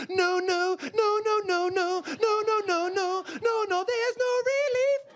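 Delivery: high, rising mock wail of pain; mock panic. Stung newcomer as the pain won't stop, mock panicked wail. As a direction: pile up the no's in mounting panic. Sting keeps going, panicking.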